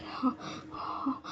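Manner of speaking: slow, breathless whisper; barely able to get it out; weak whisper. Retold or imitated. Injured Benchao answering friends in a faint slow whisper. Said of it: "बात भी नहीं कर पा रही थी".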